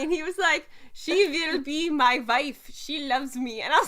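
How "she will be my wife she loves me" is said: slow, Germanic-sounding fake French accent; over-the-top French accent. Mimicking a festival actor's line, bad French accent. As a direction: ham up a bad French accent, loud and drawn out. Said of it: "this one guy had a French accent".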